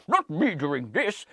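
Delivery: slow, put-on Santa voice; jokey disclaimer in a Santa voice; drawn-out mock Santa; Father Christmas. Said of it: "a Father Christmas will read it".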